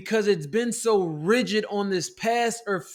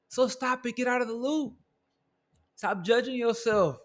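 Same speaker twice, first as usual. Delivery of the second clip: softer, dropping right down after the shout; sudden soft command; 3 semitones higher, 5 dB quieter than usual. Real, not acted. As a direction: pull back to a firm, quiet order. Drops after shouting climax.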